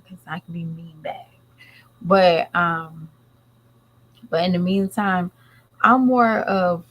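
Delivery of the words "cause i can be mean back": soft sly whisper; whispered threat. Real, not acted. Warning viewers she can be mean too, whispered. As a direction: drop it to a whisper.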